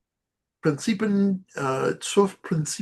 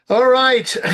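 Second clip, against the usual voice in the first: loud all right; 10 semitones higher, 8 dB louder than usual. Real, not acted. Loud call to move on.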